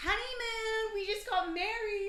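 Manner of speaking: high, loud mock whoop; imitated drunk gushing. Drunk husband spewing to strangers, imitated gushing. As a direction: crow it like a sloshed newlywed. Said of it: "He starts spewing out".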